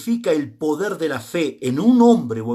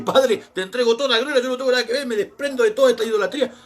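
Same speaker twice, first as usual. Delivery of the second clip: higher, quick, hammed-up pleading prayer; mock-fervent prayer, higher and faster; retold prayer; 5 semitones higher, faster than usual. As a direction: kneel and disown it all, half laughing. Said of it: "lo primero que hice fue arrodillarme y decir".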